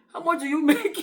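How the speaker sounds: mock interviewer cracking up at the end; breaking into laughter. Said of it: "some will be serious".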